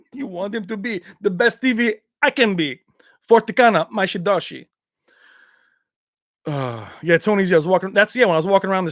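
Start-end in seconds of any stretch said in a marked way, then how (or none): 0.1-4.6 put-on accented martial-arts hero addressing his master; mock-earnest movie hero, foreign accent; Van Damme impression. Telling Robert about the best TV, Van Damme impression. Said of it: "I'll do it in Van Damme's voice".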